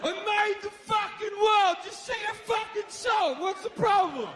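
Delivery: high-pitched, exasperated yell as God; indignant shout. Acted. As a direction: demand it like an affronted, impatient God. God berates a hesitant crowd.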